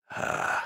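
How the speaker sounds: soft breathy grunt of satisfaction; pleased low grunt; satisfied grunt. A narrator's reading. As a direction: grunt like a hunting lion. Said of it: "zufriedene Grunzen eines jagenden Löwen".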